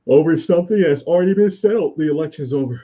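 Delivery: yawning mid-sentence. Real, not acted. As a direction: talk through a yawn. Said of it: "Sorry about the yawning".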